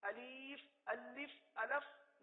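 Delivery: الف said three wrong ways: "aleef, allif, alaf"; slow list of faulty الف readings; wrong alif pronunciations. Shown as a demonstration. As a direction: run through mispronounced الف, stretched, doubled, then "alaf". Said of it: "نہیں پڑھنا".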